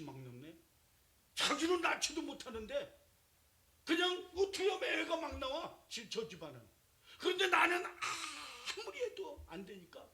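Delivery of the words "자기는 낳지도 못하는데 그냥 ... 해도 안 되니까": high, loud wail of a barren woman; anguished Hannah. Retold or imitated. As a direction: cry out her envy and grief, half sobbing. Hannah's anguish voiced loudly.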